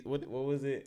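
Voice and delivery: usual speaking voice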